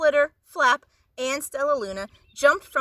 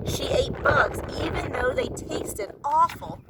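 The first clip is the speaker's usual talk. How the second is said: usual speaking voice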